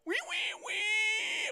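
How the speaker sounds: tiny high-pitched wee wee squeal. Pretend. Streamer squeals at his chat in a tiny high voice. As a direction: squeal like a little piggy.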